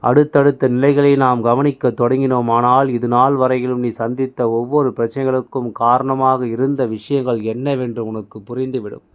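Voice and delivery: normal talking voice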